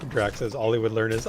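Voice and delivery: ordinary talk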